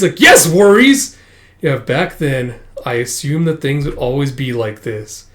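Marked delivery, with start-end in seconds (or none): 0.2-1.2 high, loud mock retort; exasperated retort. Answering Chocho's no worries, exasperated retort. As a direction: fire back in exasperation.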